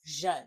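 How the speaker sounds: "zhun" said with a buzzing zh then un; syllable demo. Shown as a demonstration. Teacher showing the second sound of SI, clearly. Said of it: "the syllable shun and shun".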